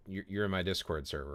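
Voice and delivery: ordinary talk, nothing marked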